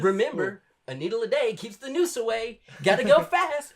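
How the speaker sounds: high, loud, wired cartoon hedgehog; crackhead Sonic. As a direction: manic school-visit mascot, way too hyped. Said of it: "the crackhead Sonic impression".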